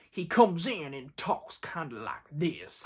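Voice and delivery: slow, low, soft Southern drawl accent; Benoit Blanc accent. Shown as a demonstration. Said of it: "I had a better accent".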